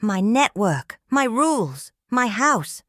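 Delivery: high, insistent angry claims; screamed outburst. A narrator's reading. Host claiming his studio from guest, high insistent outburst. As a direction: raise the pitch and punch each item. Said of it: "Colbert screamed, cracking completely".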